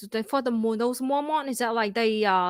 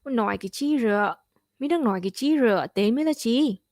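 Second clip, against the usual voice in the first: Vien accent; faster than usual. Shown as a demonstration. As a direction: speak it like a Vien local. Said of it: "Can you do a Vien impression?".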